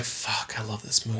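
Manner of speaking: low, soft, whispery aside; retold quiet marvelling to oneself; hushed awe. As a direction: say it under your breath, reverently. Retold awed reaction to film.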